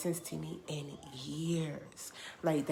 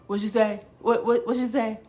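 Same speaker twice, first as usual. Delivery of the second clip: loud, higher, stammered demand to hear it; urgent disbelief; 5 semitones higher, 11 dB louder than usual. Retold or imitated. Storyteller talking back to the song, loud startled disbelief. Reenacts replaying the astonishing lyric.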